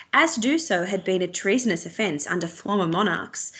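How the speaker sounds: ordinary delivery, nothing marked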